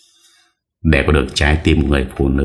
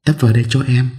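put-on young woman voice; 8 semitones higher, much faster than usual. A narrator's reading. Girl asks driver to pull over.

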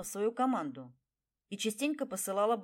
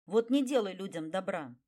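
usual speaking voice

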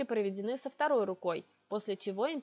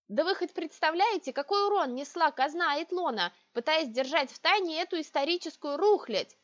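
indignant; 6 semitones higher, 3 dB louder than usual. A narrator's reading. Heroine indignant outburst.

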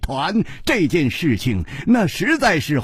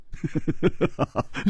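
sly chuckle; 7 dB quieter than usual. Acted. Ji teases flustered Hu.

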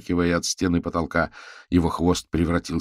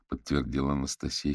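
ordinary delivery

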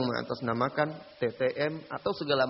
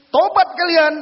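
much higher, loud order; stern command; 14 semitones higher, 12 dB louder, slower than usual. Retold or imitated. Ustaz ordering the teens to repent, loud and stern.

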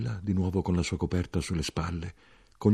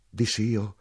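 ordinary delivery, nothing marked

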